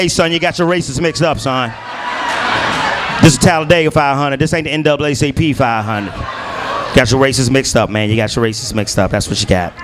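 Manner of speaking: low, drawling Southern sheriff. Retold or imitated. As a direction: Alabama sheriff pulling the driver over. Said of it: "I heard the sheriff say".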